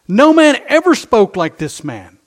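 loud ringing proclamation of man; proclaimed loud. Shown as a demonstration. Preacher proclaiming the officers' verdict, loud. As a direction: declare it to the back row.

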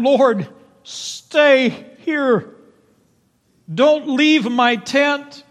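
very slow, high and loud begging; pleading plea. Acted. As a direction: stretch every word, imploring. Gideon begging God to stay.

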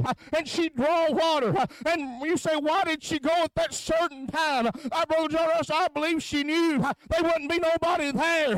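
high, rhythmic preaching with huh breaths; fiery revival preacher; preaching at full force. Acted. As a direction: chant the sermon, gasping huh between phrases.

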